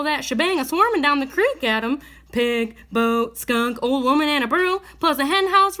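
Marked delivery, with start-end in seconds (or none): none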